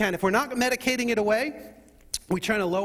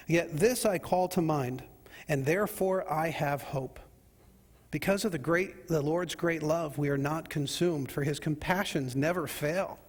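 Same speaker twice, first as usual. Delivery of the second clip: scripture reading; 3 semitones lower than usual. Acted. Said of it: "Take a look at verse twenty-one".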